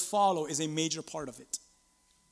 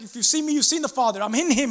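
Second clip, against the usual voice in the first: raised, insistent reply; Jesus answering Philip; 5 semitones higher, 5 dB louder, faster than usual. Acted. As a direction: answer emphatically, pitched up high. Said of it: "And he's like".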